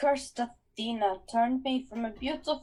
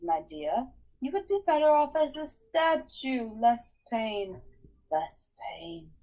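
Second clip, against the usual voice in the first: seductive coaxing. Acted. Medusa coaxing Percy.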